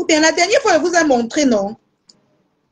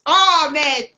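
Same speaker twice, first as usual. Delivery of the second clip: ordinary talk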